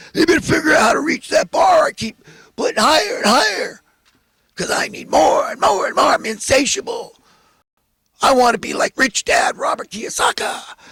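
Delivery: high, loud sneering landlord rant; mocking greedy landlord. Mocking a greedy landlord to his listeners, shouting.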